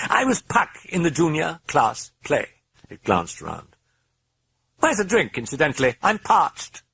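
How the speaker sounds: high, mocking British accent; cheeky British voice. A narrator's reading. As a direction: tease the priest. British voice chatters.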